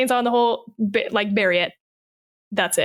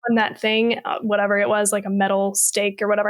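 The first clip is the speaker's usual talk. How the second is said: ordinary talk, nothing marked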